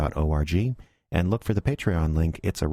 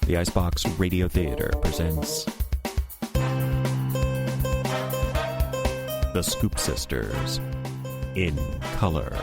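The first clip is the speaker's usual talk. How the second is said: measured announcer read with big pauses; show title announcer. Acted. Announcer introducing cast and episode, deliberate title read. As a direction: present each credit with a flourish.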